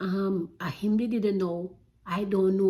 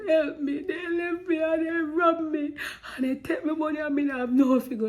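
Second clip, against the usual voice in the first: high, loud, panicked cry for help; desperate plea for help; 8 semitones higher, 4 dB louder than usual. Retold or imitated. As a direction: beg them to help you, voice up high and loud. Pleading with strangers after robbery.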